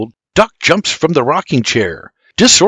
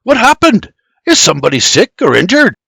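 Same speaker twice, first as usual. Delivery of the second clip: higher, louder startled questions; Geoffrey's alarmed questioning; 7 semitones higher, 5 dB louder than usual. A narrator's reading. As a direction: ask with quick rising alarm. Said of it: "Geoffrey asks".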